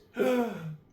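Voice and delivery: high, audible gasp; sharp intake of surprise; startled gasp. Acted. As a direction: gasp as if taken aback. Sister gasps at handsome stranger.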